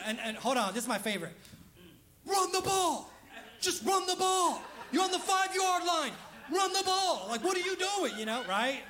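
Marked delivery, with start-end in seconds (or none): 2.3-8.2 high-pitched yelling at the TV; exasperated fan yelling. Acted. Acting out a fan yelling at the game.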